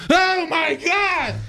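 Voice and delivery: put-on high, slow "Oh my God" from a frightened old man; a scared, drawn-out exclamation way up high; startled dad imitation. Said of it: "And he goes".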